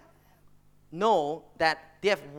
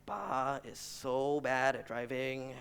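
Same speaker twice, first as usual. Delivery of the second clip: muttering; 5 semitones lower, 7 dB quieter, much slower than usual. Retold or imitated. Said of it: "He's just muttering to himself".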